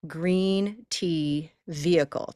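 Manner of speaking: GREEN TEA VEHICLE slowly, long EE in each word; slow demo for repeating; model pronunciation. Shown as a demonstration. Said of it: "Try that".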